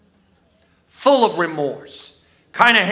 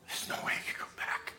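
whisper; 18 dB quieter, faster than usual. Acted. Whispered despair, then repeated aloud.

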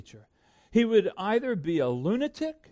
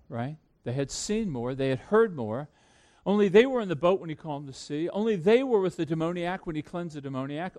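ordinary talk

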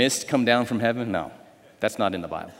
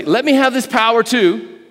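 higher, slow eager plea; exclaimed request; 8 semitones higher, 4 dB louder than usual. Acted. Simon asking Peter for the power, eager. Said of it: "he exclaimed".

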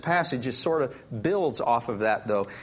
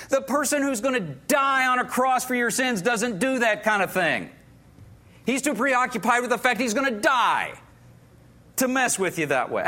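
raised, impassioned preaching voice; fervent preaching; 6 semitones higher than usual. Real, not acted. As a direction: preach it with rising force. Preacher stressing Jesus' sacrifice.

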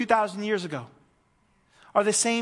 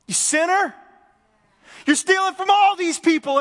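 loud, high, scolding shout; harsh rebuke; 10 semitones higher, 7 dB louder than usual. Pretend. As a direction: condemn the sinner in front of the crowd, hammed-up. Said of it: "he could have had some real umph".

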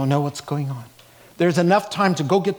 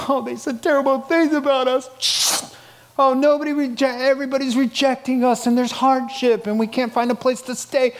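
high-pitched, hammed-up self-pitying complaint; mock lament; 9 semitones higher than usual. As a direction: moan in pretend distress about being picked on. Mock complaint of persecuted apostles.

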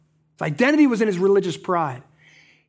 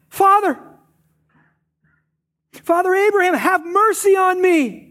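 higher, louder cry of a man begging for mercy; pleading call; 8 semitones higher, 4 dB louder than usual. Retold or imitated. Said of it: "He calls out".

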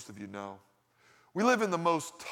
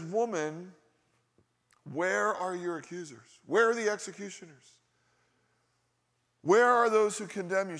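building challenge; 4 semitones higher, 4 dB louder than usual. Acted. Jesus confronting the accusers.

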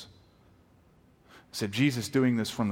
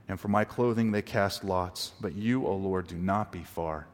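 scripture reading; 3 semitones higher, 4 dB louder than usual. Acted. Reads Psalm 22 verses aloud.

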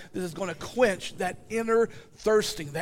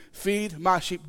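ordinary talk, nothing marked

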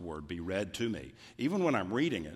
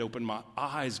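ordinary delivery

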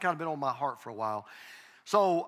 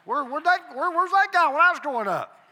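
higher, louder mock protest; mock indignant sons; 10 semitones higher, 7 dB louder than usual. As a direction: play the grown son's outrage. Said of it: "and they'll say".

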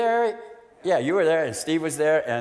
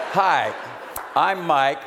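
louder, higher restart; emphatic introduction; 4 semitones higher, 3 dB louder, much slower than usual. Shown as a demonstration. Speaker restarting his meeting introduction, emphatic and loud. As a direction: announce yourself boldly to the room.

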